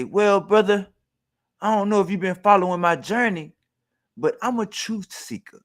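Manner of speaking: a hint of J J's preachy brother tone; mocking J J. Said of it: "He say".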